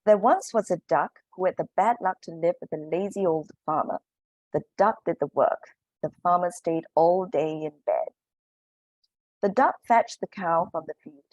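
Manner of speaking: a lively picture-book reading with voices for the characters; reading to children. Acted. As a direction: read it as you would to a class of little ones. Reads a picture book to the class.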